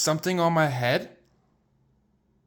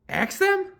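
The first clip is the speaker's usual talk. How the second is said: much higher, rising astonished question; high squeaky disbelief. Acted. Elephant amazed at Piggy's idea, high squeaky question.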